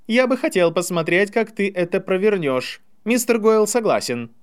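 high, projected acceptance; loudly said. A narrator's reading. Draco accepts the challenge publicly, loudly. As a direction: declare it for the crowd to hear. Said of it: "громко сказал Драко".

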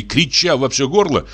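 usual speaking voice

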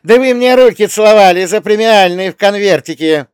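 high, loud furious yelling; furious shout. A narrator's reading. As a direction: scream at the staff in fury. Furious director berates staff.

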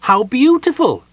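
high, loud admiring exclamation; delighted wonder. A narrator's reading. Kender marvels at the silver stair.

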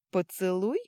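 high, rising mocking question; snide taunt. A narrator's reading. Companion suggests she paid a kiss, snide high taunt. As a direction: tease her with a loud rising question. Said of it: "съязвил Фарки".

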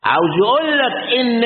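ordinary delivery

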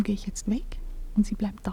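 soft, resigned whisper. Acted. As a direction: say your farewell decision under your breath. Quiet decision to leave. (leises, gefasstes Flüstern)

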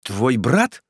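understated astonished question; amazed. A narrator's reading. Prisoner learning of his brother, understated amazement. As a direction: ask with mild amazement. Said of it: "изумился Муздаман с стыдом и радостью".

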